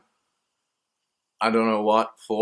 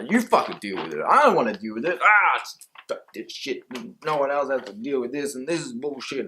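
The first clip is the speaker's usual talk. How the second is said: mock angry inner protest; 2 semitones higher than usual. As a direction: snap back at yourself like a cranky inner voice. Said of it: "the parts of my".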